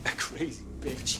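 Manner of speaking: slow, hissed whisper; whispered sneer. Acted. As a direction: spit it out slowly under breath. Taunt ends in whispered sneer.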